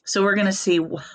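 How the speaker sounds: ordinary talk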